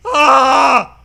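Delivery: loud, high monster roar; menacing monster bellow. Acted. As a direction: bellow it like a wounded beast. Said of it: "That's my menacing voice".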